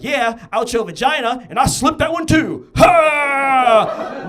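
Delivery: loud, high taunting yell; cocky shout. Retold or imitated. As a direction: crow it loud at mom. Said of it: "I was like".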